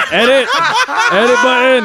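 shouting through laughter, high; laughing shout. Real, not acted. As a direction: yell it while cracking up. Calling to edit out.

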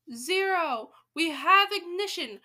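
high, forceful proclamation; triumphant announcement. A narrator's reading. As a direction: call it out like a launch. Countdown reaches its climax.

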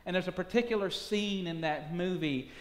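usual speaking voice

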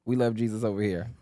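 ordinary talk

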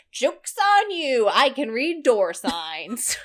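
loud, high, drawn-out gloating; mock triumphant gloat. Dog owner gloats at family training in German, mock triumphant. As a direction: crow it slowly, starting high and loud.

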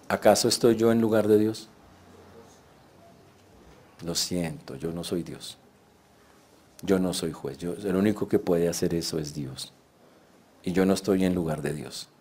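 calm the frightened brothers quietly; hushed sincere comfort; gentle reassurance. Retold or imitated.

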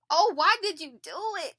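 groaning. A narrator's reading. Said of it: "they groaned".

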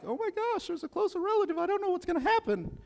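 high-pitched frantic voice; panicked Ruth. Retold or imitated. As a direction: squeal it like a panicking young woman. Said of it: "'cause you know Ruth is like".

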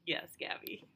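hushed whisper. Real, not acted. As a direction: whisper it softly. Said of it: "Why are we whispering?".

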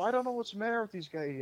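half-hearted Gallagher impression; attempted Gallagher voice. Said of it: "I can't imitate his voice".

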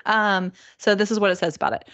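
ordinary delivery

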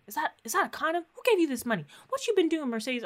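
high-pitched, mock-scandalized questioning; high retold grilling of a daughter; alarmed interrogation. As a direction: imitate a mother demanding answers, pitch jumping up. Voicing mother interrogating her daughter.

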